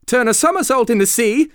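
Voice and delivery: loud, high-pitched whoop; excited cry. A narrator's reading. Mock Turtle capering wildly about, crying out. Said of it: "cried the Mock Turtle".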